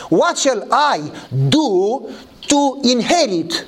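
slow, stretched-out question, leaning hard on "do"; emphatic questioning. Shown as a demonstration. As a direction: draw out "What shall I do", high, then "to inherit". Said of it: "Listen carefully to the nonsense question".

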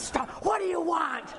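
lightly voiced gruff challenge from a big woman. Huge woman confronting strangers on her porch, mock demand. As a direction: imitate a startling demand at the door. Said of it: "just this big, huge woman comes".

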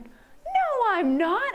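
upset denial. Retold or imitated. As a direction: protest it, stung. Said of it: "And I was like".

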